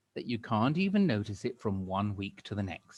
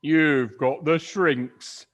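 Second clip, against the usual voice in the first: loud mocking cry; 5 semitones higher, 11 dB louder, much slower than usual. Mister Twit jeering at his wife, loud mocking cry. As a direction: jeer at her loudly. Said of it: "cried Mister Twit".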